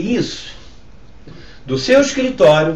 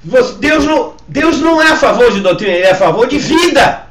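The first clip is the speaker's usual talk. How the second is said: insistent, raised sermon voice; forceful preaching; 4 semitones higher, 4 dB louder than usual. Real, not acted. Pastor urging church to stop fighting over doctrine, forceful preaching. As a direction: press the appeal on the congregation.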